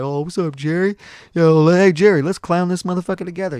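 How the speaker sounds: high-pitched imitation of a hyped-up classmate; put-on rowdy greeting; mock hype. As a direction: call out to a buddy, high and loud. Acting out classmate clowning with Jerry.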